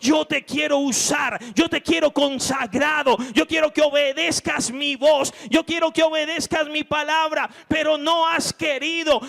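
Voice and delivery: loud, high insistent calling; voice of God. Acted. God calling the disobedient believer, loud preaching voice. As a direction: proclaim it as God claiming you.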